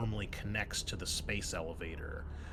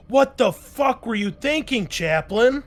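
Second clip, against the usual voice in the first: higher, much louder furious yell; furious shout; 13 semitones higher, 14 dB louder than usual. Acted. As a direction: scream at him for what he did. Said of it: "She's like".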